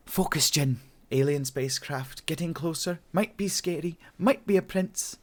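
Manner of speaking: high-pitched heroine voice; high, musing pep talk; Jen's self-talk. A narrator's reading. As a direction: weigh up the possibilities to yourself, high and lilting. Voices Jen talking to herself.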